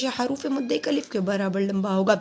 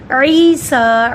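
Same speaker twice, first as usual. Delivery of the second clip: slow, louder recited word; recited demo word; 8 dB louder, much slower than usual. Shown as a demonstration. Teacher models the word for students in slow chant. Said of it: "عی کو لمبا کیا".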